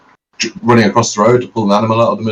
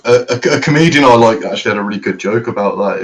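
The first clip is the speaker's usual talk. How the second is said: ordinary talk, nothing marked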